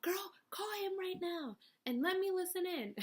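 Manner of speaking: put-on high hushed girlfriend voice; sassy friend whisper. Friend urging her to call, high and hushed. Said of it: "and she said".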